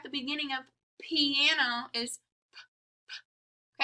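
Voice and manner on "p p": p pushed out of the lips with a puff; popped p sound. Shown as a demonstration. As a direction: pop a breathy p. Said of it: "we're kind of pushing out of our lips the p".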